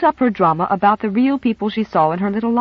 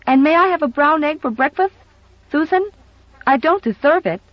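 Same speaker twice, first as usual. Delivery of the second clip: put-on high, eager little-girl voice; a child asking for a treat; hopeful little girl; 7 semitones higher than usual. Little girl asking housekeeper for a treat, eager.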